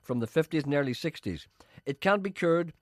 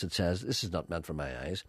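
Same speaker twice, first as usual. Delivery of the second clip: ordinary talk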